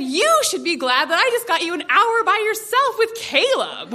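high-pitched turning of the tables; pointed retort. Retold or imitated. Mom answering daughter's plea over dinner, pointed retort. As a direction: throw the argument straight back.

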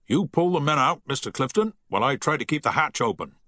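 firm naval command; the captain, commanding. A narrator's reading. Captain orders the crew to the lifeboats, commanding.

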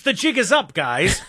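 high laughing gangster impression; 1930s gangster. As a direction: replay the old movie crook line, amused. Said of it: "like a nineteen thirties criminal".

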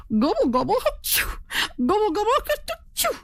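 high gobbles breaking into achoos; gobble, then burst into a high sneeze; turkey sneezing. A narrator's reading. Turkey on the dusty road, sneezing gobbles. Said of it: "The dusty road made him sneeze".

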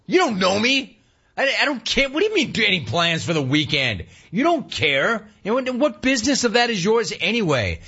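high irritated customer retort; exasperated customer to teller; mock exasperated. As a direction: play it fed up, pitched high. Host voicing himself to teller.

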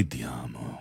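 very soft, low sweet nothing; tender murmur. Pretend. Host as if saying it to somebody beloved, tender. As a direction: breathe out ti amo like a quiet endearment. As if said to a loved one.

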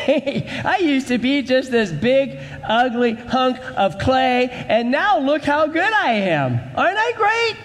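hammed-up vain lump of clay; boasting clay pot. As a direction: crow about yourself, pitch soaring. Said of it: "Why are you boasting and bragging".

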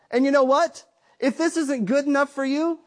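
normal talking voice

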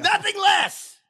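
high booming stage shout; theatrical battle cry; Kenneth Branagh impression. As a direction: roar it like a Shakespeare king. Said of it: "let's do Kenneth Branagh".

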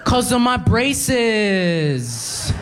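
put-on high, very slow girl's voice. Cashier girl explaining slowly, high-pitched. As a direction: drag it out like explaining to an idiot. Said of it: "looks up at me angrily and goes".

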